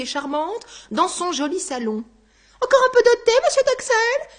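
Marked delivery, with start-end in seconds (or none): 2.6-4.3 much higher, louder, sweetly polite; high, sugary hostess offering more tea. Acted. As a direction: play the gracious lady pouring tea.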